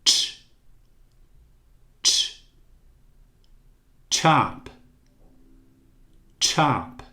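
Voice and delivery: "Ch" held alone, then "chop" with a clear T-like ch; ch sound drill. Shown as a demonstration. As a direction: isolate "ch" before "chop". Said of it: "Aqui temos um som de T, como em tio". (som de "ch" isolado em "chop")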